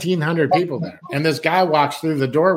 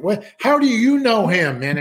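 shocked; 6 semitones higher than usual. Retold or imitated. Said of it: "from laughing to almost crying".

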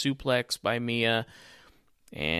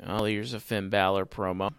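normal talking voice

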